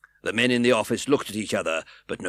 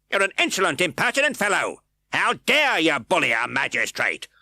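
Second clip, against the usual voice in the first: higher, louder scolding; berating magistrate; 9 semitones higher, 6 dB louder than usual. A narrator's reading. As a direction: scold him as insolent. Fang berating in reading.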